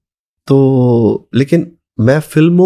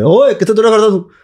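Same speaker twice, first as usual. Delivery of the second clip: high-pitched mock call to a child; imitating a villager; 7 semitones higher than usual. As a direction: lightly play a villager calling out. Villager calls out to runaway boy. (गाँव वाले की नकली पुकार)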